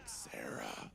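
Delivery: low unvoiced in-breath rasp; mock rasping whisper. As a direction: imitate the creepy rasping name. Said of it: "she points herself, she's like".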